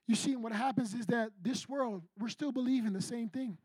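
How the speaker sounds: ordinary talk, nothing marked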